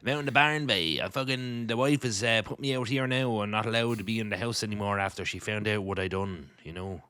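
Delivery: thick Northern Irish voice; Northern Irish accent. Pretend. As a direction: play a rough Northern Irish husband. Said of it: "I can do the whole podcast like this".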